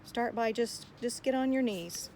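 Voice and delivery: ordinary talk, nothing marked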